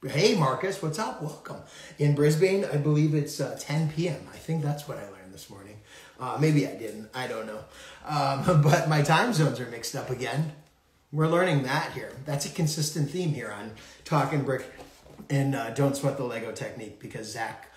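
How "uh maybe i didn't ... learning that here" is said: usual speaking voice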